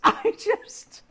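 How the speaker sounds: high, airy burst of laughter; breathless laugh. Real, not acted. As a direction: laugh it out, lost for words. Overcome laughing at post office.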